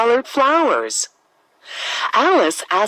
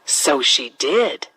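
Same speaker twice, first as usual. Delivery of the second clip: plain reading voice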